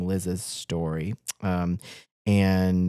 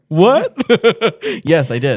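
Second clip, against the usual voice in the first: laughing; 8 semitones higher, 10 dB louder than usual. Real, not acted. Caught by cohost, laughing.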